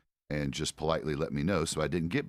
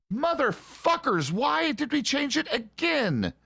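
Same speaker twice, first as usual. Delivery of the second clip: screaming rant, much higher and louder; furious yelling; 6 dB louder than usual. Real, not acted. Gamer yelling at the developers over shotgun weight, furious shout. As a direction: blow up at the developers at full volume. Raging at game devs' changes.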